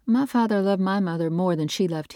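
a hint of a wistful wife; lightly voiced wife. A narrator's reading. Wife confiding about her parents, lightly voiced. Nora's voice in the story.

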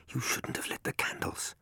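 fearful, breathless whisper; trembling whisper. A narrator's reading. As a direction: whisper it in fear, barely voiced. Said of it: "Her voice was a fearful, trembling whisper".

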